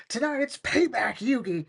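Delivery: high-pitched gloating villain; vengeful cartoon schemer; Weevil-like Mokuba. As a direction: crow it high, ending in a cackle. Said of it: "that was more Weevil than Mokuba".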